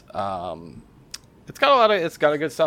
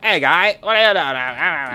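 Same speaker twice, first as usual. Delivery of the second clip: put-on South Park Canadian accent, high and loud; cartoon Canadian accent, louder; 6 semitones higher, 7 dB louder, slower than usual. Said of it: "that South Park Canadian accent to 'em".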